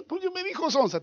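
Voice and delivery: high, soft tearful whine; whimpering crybaby answer; mock crying. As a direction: pretend to sob it out, high-pitched. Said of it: "¿Y por qué lloras?".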